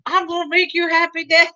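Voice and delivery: put-on high, childish voice for the son; mock deformed son. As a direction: play the eager creepy son. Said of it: "It's like".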